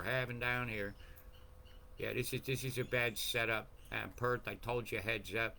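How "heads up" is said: ordinary talk, nothing marked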